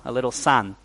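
usual speaking voice

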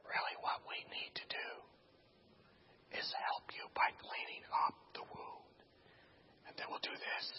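barely audible, calming whisper; hushed, voiceless explanation. Retold or imitated. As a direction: whisper it confidentially to the patient. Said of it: "we begin whispering to each other".

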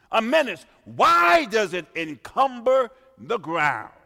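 loud, high rhetorical questions; forceful pulpit delivery; fervent preaching. Acted. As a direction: build the list to a shout. Preacher listing charges, building.

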